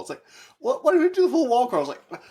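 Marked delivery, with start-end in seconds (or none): 0.6-1.8 much higher, fast confused question; baffled by the demand; bewildered. Retold or imitated. Peter asking MJ why, bewildered and high.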